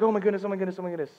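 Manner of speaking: high, fading string of exclamations; panicked repetition. Retold or imitated. Husband waiting at the hospital, fretful panic.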